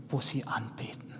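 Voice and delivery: breathy hushed whisper, trailing away; hushed, secretive whisper. Shown as a demonstration. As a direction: drop to a whisper on wo sie anbeten. Worship line repeated as whisper.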